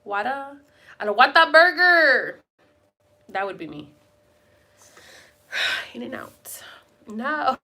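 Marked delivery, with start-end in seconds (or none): 0.1-2.4 put-on Whataburger in a light Mexican Spanish accent, slow and loud; paisa Spanish accent. Joking to viewers about being paisa, mock Spanish accent. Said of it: "Because I'm hella paisa".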